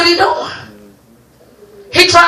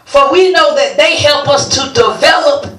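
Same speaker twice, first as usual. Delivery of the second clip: scripture reading; 3 semitones lower, slower than usual. Acted. Preacher reads scripture slowly.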